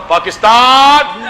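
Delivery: a long, slow, drawn-out rallying cry of Pakistan; slogan shout. Real, not acted. Preacher leading the crowd's slogan, a slow drawn-out shout. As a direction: lead the crowd into the chant with Pakistan, stretched and loud. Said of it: "میں ہی نعرہ لگاتا ہوں".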